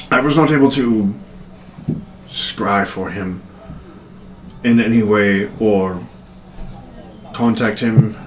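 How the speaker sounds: lightly voiced scholar with long halting pauses; hesitant scholar. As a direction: pick your words carefully giving bad news. Plays Penrith reporting failed scrying.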